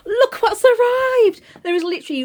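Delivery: excited reveal. Real, not acted. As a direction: stretch it out like unveiling a gift. Reveals surprise parcels to viewers.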